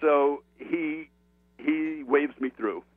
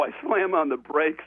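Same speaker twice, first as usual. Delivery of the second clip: normal talking voice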